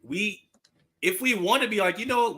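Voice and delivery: ordinary talk, nothing marked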